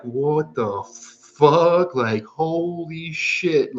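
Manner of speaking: very high, very slow, drawn-out "What the fuck?"; stunned disbelief. Retold or imitated. Sitting up mid-trip and finding everything insane, very high and slow. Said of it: "and you're like".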